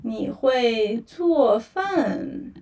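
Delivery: very slow, syllable by syllable; 你会做饭 said slowly, each syllable separate; slow demo. Shown as a demonstration. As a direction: spell it out slowly for a learner. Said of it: "first you say".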